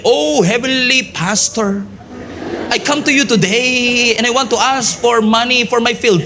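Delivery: a lightly hammed-up holy tone; mock pious prayer. Shown as a demonstration. Pastor joking how he won't talk to his father, mock pious. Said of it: "hindi ko sasabihin".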